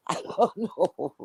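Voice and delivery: laughing. Real, not acted. Laughs off question about going live.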